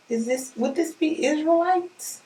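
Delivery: ordinary talk, nothing marked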